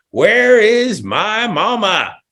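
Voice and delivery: high, slow, pleading donkey call; hammed-up donkey wail. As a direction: play a lost donkey calling for mama. Host voicing donkeys calling mama.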